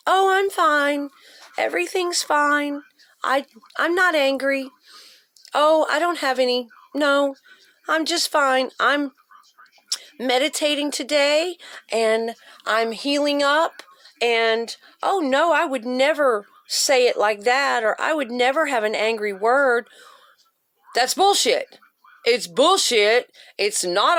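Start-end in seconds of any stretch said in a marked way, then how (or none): 0.1-17.8 mock serene, everything-is-fine voice; fake cheerful. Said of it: "overly positive". 18.0-19.9 a hint of the sugary fine voice; lightly mock the nice person; understated sweet denial; fake cheerful. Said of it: "overly positive".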